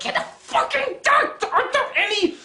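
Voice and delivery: high-pitched exasperated outburst; incredulous, high ranting; outraged yell. Real, not acted. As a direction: scream it at the author in disbelief. Outraged rant at the story.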